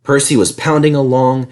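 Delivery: usual speaking voice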